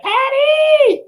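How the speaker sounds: high, drawn-out call of Patty. Retold or imitated. Speaker calling out to Miss Patty, a high stretched call. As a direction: call out Patty from across the room. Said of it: "I said".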